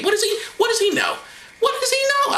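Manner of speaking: high-pitched, exasperated rhetorical question; mock indignation. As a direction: throw it up high in mock outrage. Mock indignation at Alvin Ailey.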